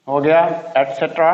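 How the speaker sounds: usual speaking voice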